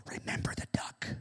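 sly threatening whisper. Acted. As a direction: breathe it as a secret threat. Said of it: "she whispered to him".

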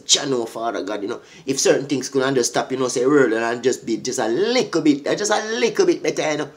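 soft, low appeal to God; hushed plea to Jah; quiet prayer. Retold or imitated. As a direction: murmur it like a weary prayer. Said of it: "come and say".

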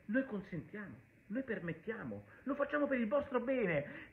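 softly quoting the PM with mock condescension; mocking the PM's tone. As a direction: lightly mimic the prime minister's patronising decree. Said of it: "quando ho visto il tono".

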